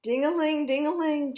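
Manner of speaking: high, loud ringing telephone sound; playful ringing noise for kids; phone ring imitation. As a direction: ring it out like an old phone bell.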